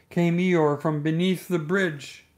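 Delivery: ordinary delivery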